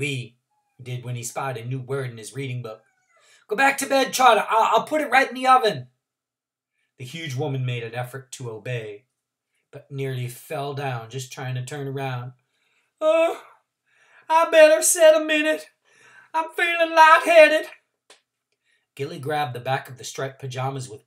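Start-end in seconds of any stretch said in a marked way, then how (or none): 3.5-5.9 put-on flustered girl. Gilly calming Trotter. 13.0-17.7 put-on faint old woman. Trotter faint.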